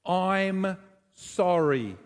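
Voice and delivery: drawn-out, deliberate I'm sorry; slow practice apology. Shown as a demonstration. Pastor modelling an apology for his congregation, slow and earnest. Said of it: "Let's have a bit of a practice".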